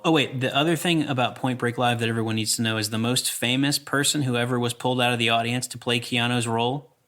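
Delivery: ordinary talk, nothing marked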